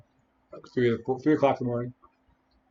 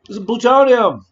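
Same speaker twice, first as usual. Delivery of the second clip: loud and high, exasperated; shouted; 10 semitones higher, 12 dB louder than usual. Pretend. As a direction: yell it at the doctor. Repeating to doctor in imagined scene.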